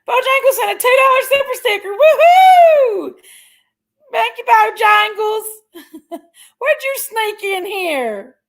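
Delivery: high, loud laughing whoop; excited squeal. Real, not acted. Streamer thanking a viewer for a super sticker, squealing with delight.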